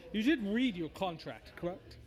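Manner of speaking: lightly voiced devil doing business; a hint of a haughty fiend; smug bone devil. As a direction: explain with mannered, theatrical patience. Game master voices bone devil.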